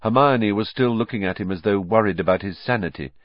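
usual speaking voice

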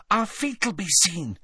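put-on high-pitched worried girl; anxious. A narrator's reading. Hermione under the cloak with her friends, anxious. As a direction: fret in a high, tense voice. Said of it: "said Hermione anxiously".

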